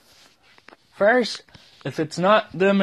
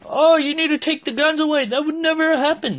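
high, loud whiny mock of a gun liberal, much higher than usual; 10 semitones higher, 4 dB louder than usual. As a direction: lampoon the liberal as shrill and preachy. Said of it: "you're gonna be like a gun liberal".

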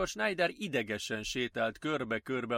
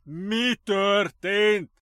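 slow, loud, high booming shout; booming call; 8 semitones higher, 8 dB louder, much slower than usual. A narrator's reading. Doctor calling to staff from afar, booming. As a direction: boom it down a corridor. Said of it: "már messziről zúgott a hangja".

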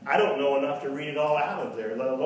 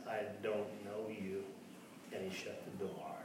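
quiet rejection; 5 semitones lower, 15 dB quieter than usual. Retold or imitated.

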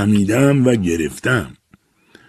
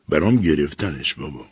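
plain reading voice

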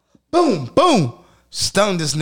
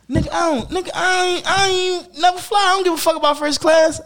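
high-pitched panicky refusal; scared friend refusing; 12 semitones higher than usual. Retold or imitated. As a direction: imitate a nervous friend balking at flying.

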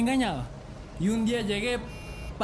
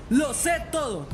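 raised, emphatic demonstration; manly, forceful; 4 semitones higher than usual. Shown as a demonstration. Friend coaches Paco to confront wife, forceful. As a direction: show him how a man says it. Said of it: "Con voz de hombre".

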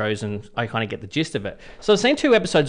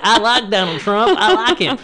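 high, slow mock Southern US drawl; southern accent; 7 semitones higher, 4 dB louder than usual. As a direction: ham up a southern American accent. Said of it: "that was my southern accent".

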